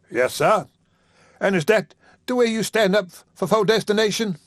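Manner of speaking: high, mildly indignant voice; a hint of bitter reproach; accusing rival. Isaac accusing Haywood.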